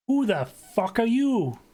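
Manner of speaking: high, slow New York challenge; thick New York accent. Acted. Neighbour answering the door to a stranger, thick New York accent. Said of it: "immediately hits you with his, his thick New York accent".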